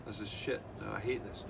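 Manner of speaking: ordinary delivery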